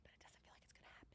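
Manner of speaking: hushed, breathy doubtful whisper. Real, not acted. Bettor whispering to chat that the bet won't hit. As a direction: whisper it like you don't believe it.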